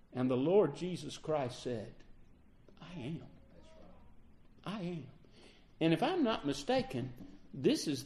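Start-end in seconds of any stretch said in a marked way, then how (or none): 2.8-5.1 much softer, solemn "I am"; almost hushed, let the words land with awe; soft solemn declaration; hushed reverent. Acted. Jesus's solemn answer, reverently repeated.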